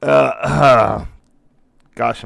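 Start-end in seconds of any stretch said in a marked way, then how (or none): 0.0-1.9 hoarse, losing his voice; scratchy, worn-out voice. Real, not acted. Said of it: "I'm losing my voice here".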